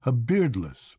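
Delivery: usual speaking voice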